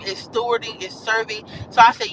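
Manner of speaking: ordinary talk, nothing marked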